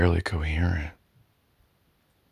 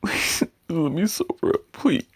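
higher, voice straining as you beg; frantic begging; high desperate plea; 11 semitones higher, 3 dB louder than usual. Acted. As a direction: plead with rising panic. Begging partner to stop.

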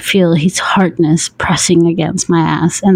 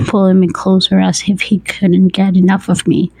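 ordinary delivery, nothing marked

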